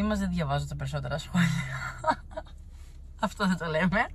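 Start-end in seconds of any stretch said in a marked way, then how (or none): 1.5-3.2 high, airy unvoiced laugh; breathy laugh. Real, not acted. Admitting she skips the comments, a soft airy laugh. Laughs off her own confession.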